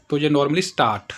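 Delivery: ordinary talk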